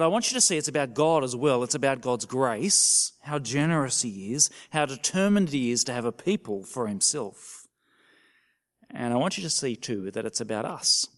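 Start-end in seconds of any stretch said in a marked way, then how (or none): none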